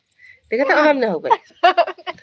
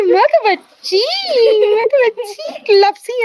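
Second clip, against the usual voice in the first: high pleading; 10 semitones higher, 3 dB louder, slower than usual. Real, not acted. Pleading with the child.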